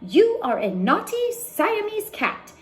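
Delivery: high, mock-stern mama scolding her kitten; a hammed-up scolding mother.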